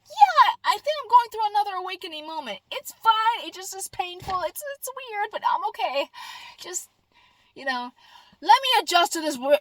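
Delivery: high, pinched voice begging for a pause; strained pleading. Retold or imitated. Answering coworkers about energy hits, high strained pleading. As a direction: plead like it's too much at once. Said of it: "I'm like".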